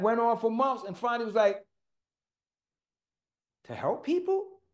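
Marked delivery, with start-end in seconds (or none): 3.6-4.6 unsure rising question, pitched much higher; sheepish questioning answer. Retold or imitated. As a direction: offer it hesitantly, like a guess. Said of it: "And I said it like a question".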